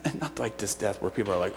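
normal talking voice

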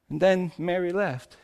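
ordinary talk

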